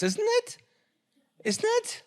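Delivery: very high, squeaky "isn't it"; high-pitched mocking rising whine; mocking whiny question. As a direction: mimic a petty complainer fishing for agreement.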